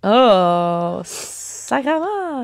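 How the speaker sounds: very slow, stretched word; drawn out. Real, not acted. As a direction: stretch the word out as if to say it's complicated. Drawn-out reaction to a personal question. (mot étiré très lentement)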